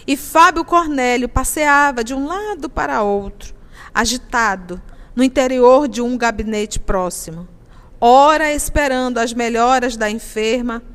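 loud, urgent storytelling read; dramatic narration. A narrator's reading. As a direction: push the drama of the scene. Reads novel narration, louder.

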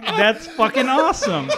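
high, loud delight with a laugh; chuffed, laughing exclamation; retold proud elation. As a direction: relive being thrilled by a compliment. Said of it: "I felt so good".

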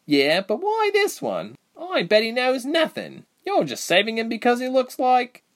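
lightly voiced young woman, heated; Reshma's voice, pressing. A narrator's reading.

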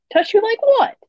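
high sneering taunt; mock her in a high sneer. A narrator's reading. Soldier mocking her.

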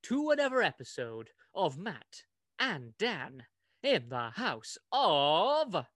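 loud, slow show host welcome; announcer greeting. Acted.

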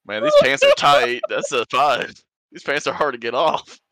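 squeaky high strained voice. Acted. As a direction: strain while tugging something tight. Straining to pull off pants.

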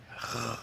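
a soft throat r, barely voiced; quiet uvular r; r casi como los franceses; French-like r. Shown as a demonstration. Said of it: "casi como los franceses".

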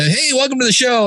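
bright high-pitched show opener. Retold or imitated. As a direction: demonstrate your peppy on-mic greeting. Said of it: "I'm a little more up".

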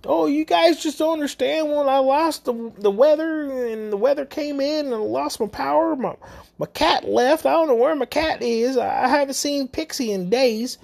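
high, whiny lament about a lost cat; mock whiny old lady. Imitating the older lady pouring out her troubles, high and whiny. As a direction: ham up a self-pitying old woman, pitched high. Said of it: "her life and how her life is hard".

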